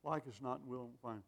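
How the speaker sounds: soft, mock-soothing doctor's voice; imitating reassuring doctors. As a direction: hush it like a doctor calming a patient. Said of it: "they said".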